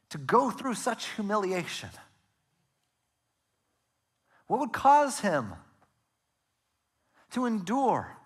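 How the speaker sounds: high, slow, pleading preacher's questions; drawn-out, high-pitched wonder; impassioned questioning. Real, not acted. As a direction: ask it in aching disbelief, pitched high with long pauses.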